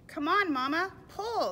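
high, loud plea to pull; insistent little storybook animal; urging young donkey. Acted. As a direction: egg Mama on, pitched right up. Young donkey urges mother on.

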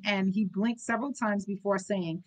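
ordinary delivery

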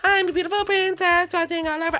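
high, dainty princess voice. Pretend. A new princess greeting the mole in a high voice. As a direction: play the princess sweet and high.

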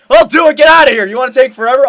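high, loud taunt; impatient shouting. Real, not acted. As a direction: yell at a shooter who is stalling. Taunts a stalling shooter.

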